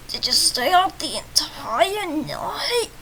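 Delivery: slow, high, stretched-out grogginess; groggy voice. Acted. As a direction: drag the words out as if half asleep. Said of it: "That's my groggy voice".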